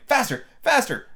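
high-pitched, urgent cry; excited Queen urging speed. A narrator's reading. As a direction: spur the runner on in a high voice. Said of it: "cried the queen".

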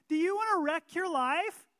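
loud, high, hammed-up tempter; Satan's bait. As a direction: ham up the tempter's blunt pitch. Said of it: "drops the hook in the water and says".

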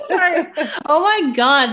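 high giggles breaking into words; can't stop laughing; overwhelmed laughter. Real, not acted. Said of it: "I was overwhelmed".

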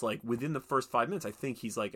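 ordinary delivery

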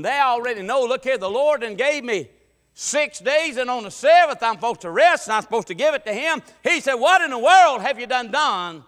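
loud, high sermon delivery; high, driving pulpit shout; full-force preaching. Acted. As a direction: preach it out at the top of your voice.

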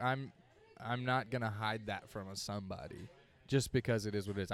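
ordinary talk

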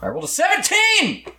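high, thrilled cry of victory; excited shout. Real, not acted. Big dice roll success.

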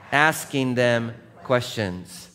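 slow and weighted; heavy words. Shown as a demonstration. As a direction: say "asking them questions" like it weighs five hundred pounds. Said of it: "Here's a five hundred-pound word".

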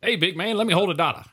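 squeaky street hustler ask; panhandler voice. Retold or imitated. Imitating radio panhandler.

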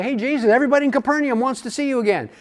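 high, rushed, eager pitch; disciples' eager report. Acted. Disciples urge Jesus back to Capernaum, eager report. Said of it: "when they come to him and say".

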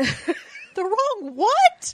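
drawn-out, high incredulous question; baffled disbelief. Real, not acted. Podcaster querying co-host's odd pronunciation, baffled and drawn out. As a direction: stretch it out, pitch jumping up in disbelief.

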